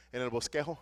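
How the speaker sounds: ordinary talk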